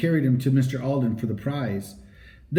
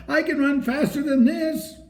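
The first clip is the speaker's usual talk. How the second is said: high, urgent self-urging; urging himself; 13 semitones higher than usual. A narrator's reading. Henry urging himself on mid-race, high urgent cry. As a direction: push yourself on, high and urgent. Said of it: "cried Henry to himself".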